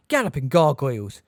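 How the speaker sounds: loud shocked exclamation; an old wizard's startled oath; shouted. A narrator's reading. Examiner seeing McGonagall stunned, loud shocked cry. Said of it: "shouted Professor Tofty".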